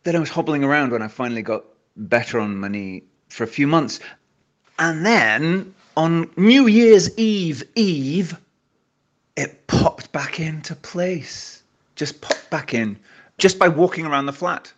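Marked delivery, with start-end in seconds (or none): 4.8-11.6 dramatic slow reveal. Real, not acted. Dramatic build to the knee reveal.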